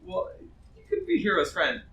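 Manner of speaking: normal talking voice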